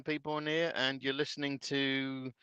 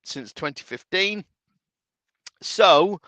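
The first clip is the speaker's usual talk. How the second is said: with hiccups; 5 semitones higher, 8 dB louder, slower than usual. Real, not acted. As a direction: still hiccuping. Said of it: "I've got the hiccups".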